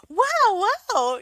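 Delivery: high, loud amazed wows; excited wows. Retold or imitated. Brother reacting to news of his niece, amazed. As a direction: let the amazement burst out. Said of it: "He got all excited".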